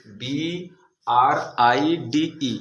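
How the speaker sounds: letters spelled out very slowly; teacher spelling B-R-I-D-G-E letter by letter, slowly. Shown as a demonstration. Teacher spelling a word for pupils, slow and clear. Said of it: "जिसको उच्चार के साथ पढ़ेंगे".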